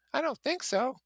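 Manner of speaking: very high, innocent Daisy; innocently. Acted. Daisy answering her cousin's joke about the chauffeur, very high and innocent. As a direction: sound wide-eyed and blameless. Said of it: "she said innocently".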